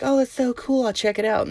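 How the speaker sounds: very high-pitched mock enthusiasm; politely nice ladies. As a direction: imitate women feigning polite interest. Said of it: "They were just being nice".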